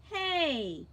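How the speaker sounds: sharp loud call for attention; demanding hey. Acted. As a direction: call out to stop them.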